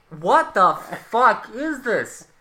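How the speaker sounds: loud, high, drawn-out bafflement; exasperated disbelief. Retold or imitated. Host retells reaction to the movie scene, loud exasperated disbelief. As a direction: punch out each word, slow and incredulous.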